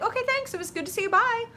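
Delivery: high, chirpy mock farewell; breezy goodbye. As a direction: wave it off brightly. Voicing the easy goodbye to truth.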